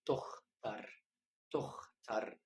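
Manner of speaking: تختر said slowly as German Tochter, rough kh; teacher modelling تختر as Tochter; German pronunciation. Shown as a demonstration. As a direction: drag out تختر, scraping the kh. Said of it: "اقرأ الكلمة دي معايا".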